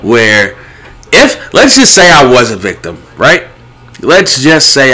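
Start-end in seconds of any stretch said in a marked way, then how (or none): none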